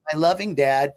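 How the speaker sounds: usual speaking voice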